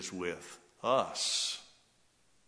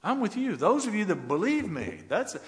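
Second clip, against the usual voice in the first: much higher and louder, fervent; rising preaching; 9 semitones higher, 8 dB louder than usual. Acted. Preacher voicing the Lord to believers, fervent cry.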